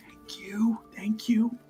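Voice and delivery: whispery, slightly high aside; hushed thanks. Acted. Aiden gesturing to Aosia to leave, hushed. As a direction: whisper it softly as a discreet dismissal.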